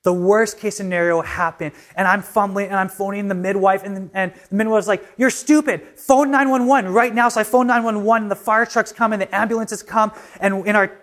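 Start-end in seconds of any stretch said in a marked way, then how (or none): 5.2-7.3 higher-pitched, forceful retold command; urgent order. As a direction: imitate an alarmed midwife giving orders. Midwife's urgent order in retold emergency.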